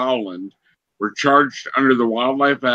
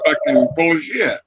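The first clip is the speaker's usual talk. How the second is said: retold telling off. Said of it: "I'll be like".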